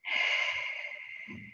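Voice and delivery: long, airy sigh out; demonstrated exhale. Shown as a demonstration. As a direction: breathe out slowly and audibly. Demonstrates breathing during guided meditation.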